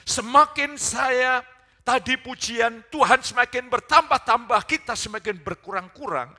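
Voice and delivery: loud, high pulpit proclamation; preaching at full force. Real, not acted. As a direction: proclaim the verse at full volume, high above normal talk. (khotbah berapi-api dengan suara lantang)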